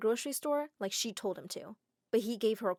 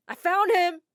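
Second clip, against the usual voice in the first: loud, higher shout of victory; triumphant yell; 8 semitones higher, 10 dB louder, slower than usual. Acted. Girl carrying Adam, yelling loud and high. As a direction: yell it out to everyone.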